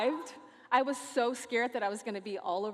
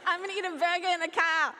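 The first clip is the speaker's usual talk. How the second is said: put-on higher, louder botched Australian accent; attempted Aussie accent, louder; 7 semitones higher, 5 dB louder, faster than usual. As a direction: repeat it loudly in a bad Aussie accent. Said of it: "I don't know how to do an Aussie accent".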